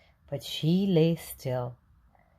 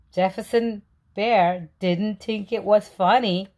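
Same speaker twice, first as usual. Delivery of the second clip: plain reading voice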